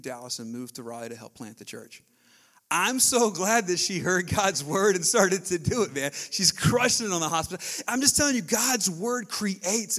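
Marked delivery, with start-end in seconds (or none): 2.7-10.0 loud, lifted preaching cadence; half-sung sermon climax; fervent preaching. Real, not acted. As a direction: proclaim it with conviction. Preaching at full force.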